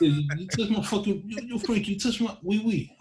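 very high mock mobster yelling; mobster voice going French. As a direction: squeal it like a wiseguy gone French. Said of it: "talking French".

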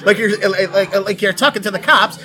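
mock mid-Atlantic accent, projected; transatlantic accent. Shown as a demonstration. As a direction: play it like an old film in a transatlantic accent. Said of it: "transatlantic accent".

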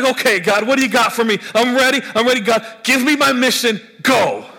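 high, loud, raring to go; eager excitement. Acted. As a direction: pump up like a kid before a game. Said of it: "You get all excited".

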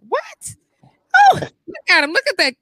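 sudden high squeal of surprise, then loud; a high, loud gasp of disbelief at the screen; surprised. Real, not acted. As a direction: jump up in pitch, shocked at what you see. Reacting to the chat live.

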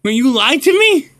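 high hammy shocked outrage; mock outrage. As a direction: overplay the betrayal, high.

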